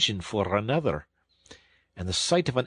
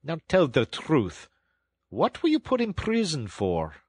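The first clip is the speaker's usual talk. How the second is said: stern; 5 semitones higher than usual. A narrator's reading. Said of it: "looked down upon him very sternly".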